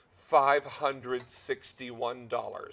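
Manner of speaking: very slow, deliberate price read; said slowly. Shown as a demonstration. Host showing viewers the retail price, slowly. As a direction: draw out every word of the figure. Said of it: "Let me say that again slowly".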